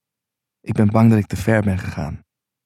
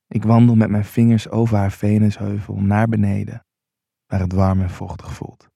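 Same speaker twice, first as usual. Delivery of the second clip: usual speaking voice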